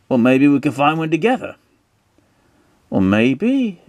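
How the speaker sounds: high mock British character voice; put-on character voice. Stanley and man teaming up for a tree, high put-on voice. Two characters agreeing, acted.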